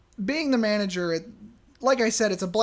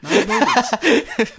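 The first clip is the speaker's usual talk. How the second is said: slow, louder, laughing through it; laughing correction; 3 semitones higher, 8 dB louder, much slower than usual. Real, not acted. Storyteller laughs correcting her friend's guess. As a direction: correct her while cracking up. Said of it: "I'm sorry".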